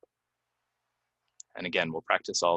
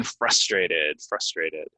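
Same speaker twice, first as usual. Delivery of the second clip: "frustrated" said FRUS-tray-ted; first-syllable stress; 2 semitones lower, much slower than usual. Shown as a demonstration. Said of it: "word stress again".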